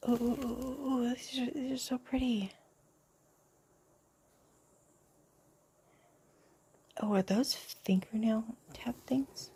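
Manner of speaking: high, soft oohs; excited hushed ooh; delighted cooing. Real, not acted. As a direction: coo over something pretty. Cooing over pretty nail items.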